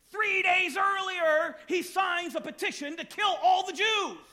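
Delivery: loud, high indignant shouting at the king; preacher scolding a foolish king; exasperated rant. Real, not acted. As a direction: vent the frustration at full volume. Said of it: "I got that off my chest".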